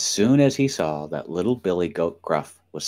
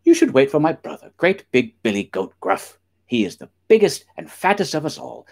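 lightly voiced goat, pitched up; higher, louder goat persuasion; middle billy goat; 7 semitones higher, 4 dB louder than usual. A narrator's reading. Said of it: "he said".

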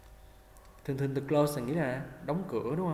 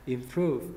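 "Improve" as im-PROVE; stress second syllable; 4 dB louder than usual. Shown as a demonstration. Teacher models the verb's stress, "Improve" stressed on PROVE. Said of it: "mình nhấn âm, âm hai".

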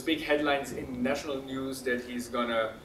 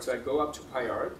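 usual speaking voice

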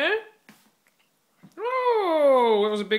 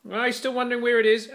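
normal talking voice

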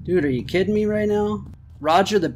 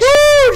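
very high, loud woo; triumphant whoop; 11 dB louder than usual. Real, not acted. Player celebrating a last-second shot, loud high whoop. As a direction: let out a piercing victory whoop.

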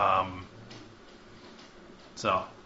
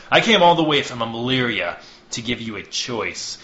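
threatening envoy; 6 dB louder than usual. Acted. Envoy Hawthorne threatening the speaker with invasion, cold and loud. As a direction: lay down the threat coldly.

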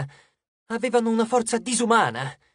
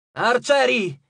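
raised high battlefield call; shouted command; 5 semitones higher, 4 dB louder, slower than usual. A narrator's reading. Frank calling his archers, shouted order. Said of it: "gridò".

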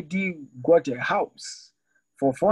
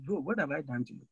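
quiet hurt question; 10 dB quieter than usual. Retold or imitated. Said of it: "The man asked me".

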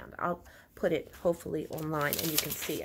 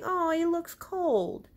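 sweet, tender; 11 semitones higher, much slower than usual. Shown as a demonstration. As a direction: say it sweetly, slowly. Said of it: "She is saying".

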